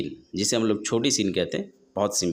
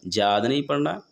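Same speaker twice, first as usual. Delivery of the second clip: usual speaking voice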